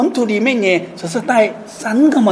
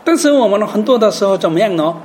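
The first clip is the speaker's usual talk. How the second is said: ordinary talk, nothing marked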